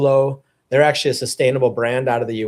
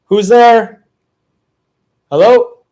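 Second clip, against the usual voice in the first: calling out; 8 semitones higher, 7 dB louder, slower than usual. Real, not acted. Calling into another room.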